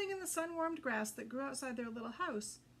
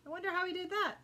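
wondering; 7 semitones higher, 5 dB louder, faster than usual. Acted. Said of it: "said Footloose".

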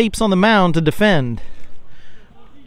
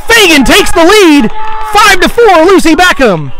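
excited; 12 semitones higher, 9 dB louder than usual. Real, not acted. Home run call, excited.

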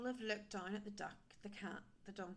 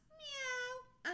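high, stretched meow; cat meow; 4 dB louder than usual. A narrator's reading. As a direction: mew it like a cat. Imitating a cat.

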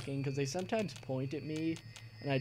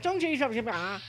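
mock gibberish enemy; 9 semitones higher than usual. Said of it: "He was like".

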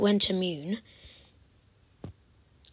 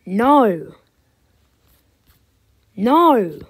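loud cry; 2 semitones higher, 11 dB louder than usual. Real, not acted. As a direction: shout at the game. Loses again.

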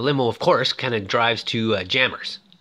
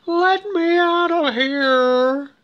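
high, slow, drawn-out plea to be let out; stretched-out desperate call from inside the trunk; muffled cry for help; 4 dB louder, much slower than usual. Retold or imitated. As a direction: wail it like you're stuck in a trunk. Franklin trapped in trunk calling out.

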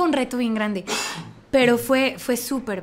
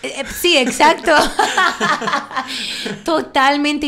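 high, louder laughing yes; laughing agreement; 6 semitones higher, 7 dB louder than usual. Real, not acted. Laughing with her husband about his lack of tact, laughing.

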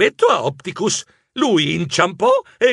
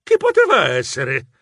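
ordinary delivery

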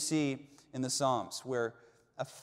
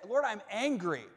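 angry prayer; 7 semitones higher than usual. Shown as a demonstration. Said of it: "just say it".

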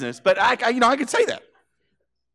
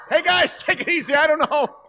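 much higher, laughing nervously; nervous laughing plea; 10 semitones higher than usual. Retold or imitated. Paralyzed man being swung up, pleading with friends, high nervous laugh.

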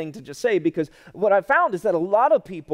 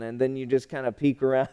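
ordinary delivery

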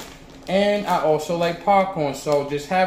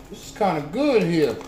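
talking between bites; chewing popcorn while talking; faster than usual. Real, not acted. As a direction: mouth partly full. Seen 0: Hand brings popcorn to mouth near 1.1; lips then move chewing.

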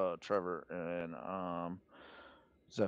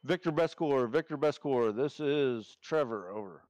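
louder, higher formal radio hail; radio call-out; 5 semitones higher, 5 dB louder than usual. Acted. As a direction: hail the station like a radio operator. Hailing a station by radio.

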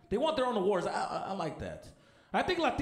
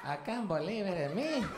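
Mexican award winner; 3 semitones higher, 3 dB quieter, slower than usual. Acted. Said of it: "Like, the Mexican freaking".

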